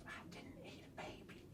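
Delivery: hushed fast whisper. Acted. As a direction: whisper it as an aside. Whispered aside.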